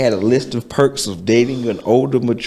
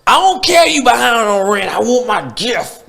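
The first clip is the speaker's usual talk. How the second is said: higher, mock-entitled partner whining for a gift; demanding partner; 11 semitones higher than usual. Imitating a demanding partner who ignores the unpaid rent. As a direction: play the partner who only wants her present. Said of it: "and they still like".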